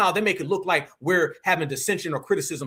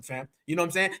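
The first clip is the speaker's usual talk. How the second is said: normal talking voice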